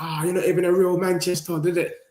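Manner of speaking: mocking rival fan. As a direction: jeer like a smug Arsenal fan. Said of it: "My brethren from Arsenal".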